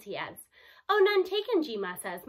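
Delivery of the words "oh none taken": lightly voiced grandma, higher and louder; a touch of a breezy old lady brushing it off; grandma waving off her grandson's request. A narrator's reading. G-Ma voiced replying.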